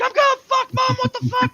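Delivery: very high, loud mock panic; retold panicked yelling. Son re-enacting waking up terrified at his mother's driving, shrill panic. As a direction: screech it at the driver in terror.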